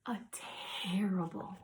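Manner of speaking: slow whisper fading to near silence; dramatic hush. A narrator's reading. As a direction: let it die away into a tense whisper.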